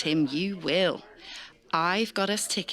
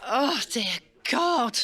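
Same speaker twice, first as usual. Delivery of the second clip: slow, drawn-out cry of exasperation, pitched up; exasperated groan; 5 semitones higher, 3 dB louder, slower than usual. Acted. Reporter fed up after the politician's drivel, drawn-out exasperated cry. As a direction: let the boredom burst out of you.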